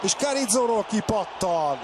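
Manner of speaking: ordinary delivery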